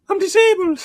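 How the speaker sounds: loud, very high imitation of a cry for help; high mock cry. Roy on the toilet floor, high mock cry. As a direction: call it out in a hammed-up high voice.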